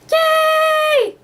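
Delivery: very high loud cheer; kid cheer. Retold or imitated. Her child self cheering at the puppets. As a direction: cheer like a delighted small child.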